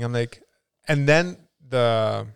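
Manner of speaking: usual speaking voice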